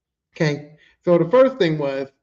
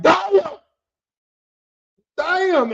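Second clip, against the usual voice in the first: shocked exclaim; 10 semitones higher, 6 dB louder than usual. Retold or imitated. Shocked reaction to the insult.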